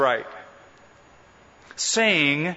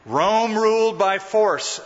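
usual speaking voice

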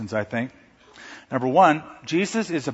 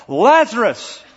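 higher, louder powerful summons; a powerful shout into the tomb; loud commanding call; 8 semitones higher, 6 dB louder, slower than usual. Acted. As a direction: command him by name. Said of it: "in a loud, commanding, powerful".

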